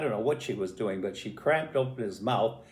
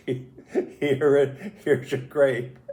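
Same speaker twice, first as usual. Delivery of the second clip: louder, higher, through a laugh; laughing retelling; 4 semitones higher, 5 dB louder, much slower than usual. Retold or imitated. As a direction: act out the offer while giggling. Retold offer, laughing while telling.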